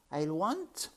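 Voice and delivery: put-on high, halting girl's voice; yearning servant girl. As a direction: begin the wish high, then stop.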